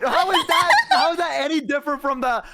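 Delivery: high, loud indignant shout; mock indignant stranger. Retelling a stranger's reaction to a greeting, high mock indignant shout. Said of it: "and they're like".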